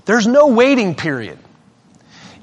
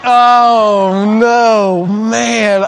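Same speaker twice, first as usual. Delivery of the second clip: mock dismay; 4 semitones higher, 5 dB louder, much slower than usual. Retold dismay at the remark.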